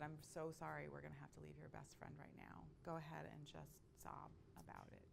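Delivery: soft, hushed sympathy; tender consoling. Retold or imitated. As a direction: gently give a grieving child permission to cry. Aunt consoles sobbing nephew, retold.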